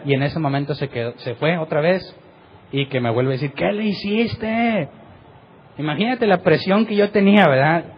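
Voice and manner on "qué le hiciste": reproche agudo y muy alargado; acusación imitada. Retold or imitated. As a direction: imita un grito acusador, sin subir el volumen. Acompañante acusa al que ora. (acusación angustiada, actuada)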